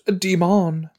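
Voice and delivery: high, put-on exclamation; high-voiced repeat. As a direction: sing out "demon" up high.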